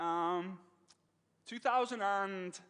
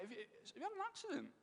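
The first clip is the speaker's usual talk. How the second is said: concerned question; 10 semitones higher, 11 dB quieter, faster than usual. Retold or imitated. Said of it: "I'm like".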